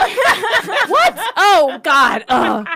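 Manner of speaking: high, loud laughing outburst. Real, not acted. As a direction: burst out laughing in disbelief. Laughing after being teased.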